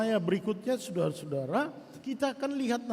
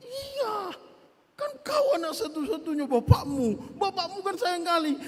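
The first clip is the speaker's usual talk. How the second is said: higher, louder goading voice; egging a friend on; 9 semitones higher, 5 dB louder than usual. Retold or imitated. Friend egging him on, higher and louder. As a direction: hype him up like a meddling friend. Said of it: "kawannya mengompori".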